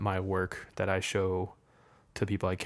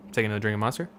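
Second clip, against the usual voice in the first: ordinary delivery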